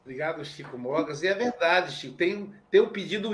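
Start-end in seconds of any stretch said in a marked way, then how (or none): none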